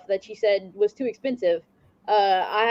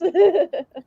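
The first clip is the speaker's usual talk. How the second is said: laugh; 8 semitones higher, 4 dB louder than usual. Real, not acted. Laughing at her own story.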